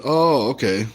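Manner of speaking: ordinary talk, nothing marked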